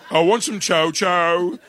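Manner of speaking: put-on slow, high Dudley accent on a Caribbean shopping list. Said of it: "everybody talks like this".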